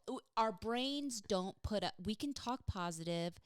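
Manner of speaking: normal talking voice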